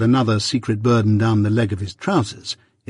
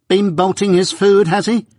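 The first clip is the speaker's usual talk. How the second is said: high-pitched, scolding outcry; crying out; 9 semitones higher, 4 dB louder than usual. A narrator's reading. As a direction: cry it out sharply, pitch way up. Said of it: "cried my sister".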